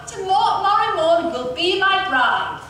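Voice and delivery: mild theatrical cry. A narrator's reading. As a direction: proclaim it as a vow. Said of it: "he cried".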